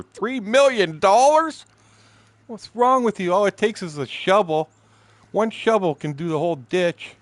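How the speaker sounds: shouting high and loud at the officials; angry rant. Retold or imitated. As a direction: yell it like you've had enough. Retold rant at town meeting.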